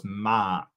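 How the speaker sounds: "Mark" with a long open "ah"; correct name pronunciation. Shown as a demonstration. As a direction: say "Mark" with the "ah" of a crow's caw. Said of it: "like a crow".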